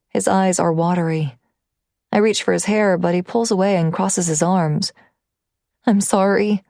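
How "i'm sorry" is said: ordinary delivery, nothing marked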